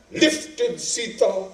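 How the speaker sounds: normal talking voice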